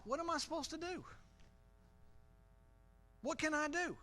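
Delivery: put-on high-pitched pleading kid; helpless child. Preacher voices a child in the street, high helpless plea. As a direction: play a kid helplessly asking Dad, high voice.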